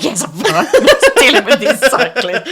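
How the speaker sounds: high, loud, laughing "I'm fine"; laughing insistence. Retold or imitated. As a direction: force a cheery, laughing reply.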